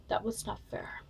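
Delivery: imitating a hurt, tearful whisper; teary whisper. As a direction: breathe it out softly, as if about to cry. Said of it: "like, kind of crying".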